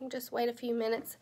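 ordinary talk

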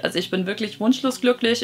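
usual speaking voice

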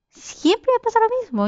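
high-pitched exasperated wail; exasperated complaint. Real, not acted. Complaining after forgetting weapons.

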